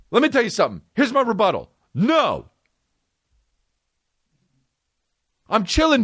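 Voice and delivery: high, insistent ranting questions; comic indignant rebuttal; heated rebuttal. Real, not acted.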